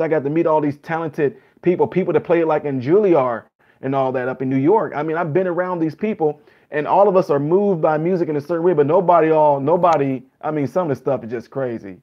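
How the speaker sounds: normal talking voice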